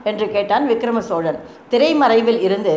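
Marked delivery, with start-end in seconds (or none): none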